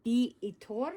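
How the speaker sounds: put-on the brand name said in a light Spanish accent. Reading the brand name aloud to viewers, lightly Spanish-accented. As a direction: read the label with a hint of a Spanish accent. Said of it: "I'm saying it with a Spanish accent".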